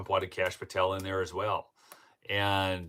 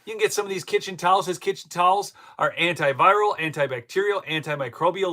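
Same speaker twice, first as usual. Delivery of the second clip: higher, louder, fast sponsor pitch; ad read; 11 semitones higher, 8 dB louder than usual. Acted. Host reads a pillow sponsor ad to viewers, upbeat. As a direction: sell the product with upbeat energy.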